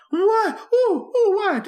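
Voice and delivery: high-pitched flustered yelps; startled awake. Retold or imitated. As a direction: imitate a wife jolted from sleep. Wife startled awake, acted.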